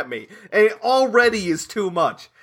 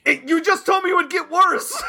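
indignant shout; 11 semitones higher than usual. Real, not acted. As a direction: yell it back in disbelief. Indignant outburst at friend.

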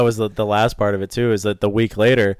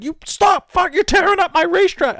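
much higher, mock angry yell; imitating a yelling track owner; 4 dB louder than usual. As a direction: bark it like the furious track boss. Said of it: "yelling at me".

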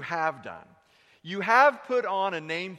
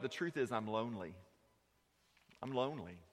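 quiet confession; 4 semitones lower, 8 dB quieter than usual. Acted. Preacher voicing a hurting churchgoer, low and soft. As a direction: lower and softer, heavy with hurt.

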